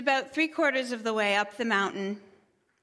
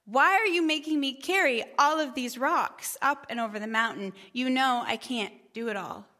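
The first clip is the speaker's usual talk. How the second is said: normal talking voice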